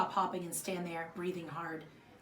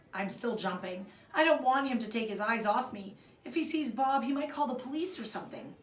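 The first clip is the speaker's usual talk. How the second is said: ordinary delivery, nothing marked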